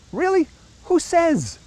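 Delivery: high, sarcastic "really?"; incredulous scoff. Real, not acted. Challenging the greatest generation claim, high incredulous. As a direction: pop up high in mock disbelief. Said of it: "mocking the oracle".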